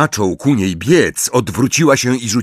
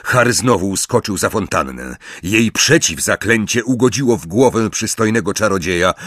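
plain reading voice

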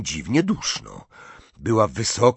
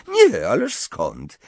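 louder, slow denial through a forced smile; fake smile; 5 dB louder, slower than usual. A narrator's reading. Hermione denies to Harry that anything is wrong, falsely cheerful. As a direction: deny it too brightly, drawn out. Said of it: "uśmiechając się sztucznie".

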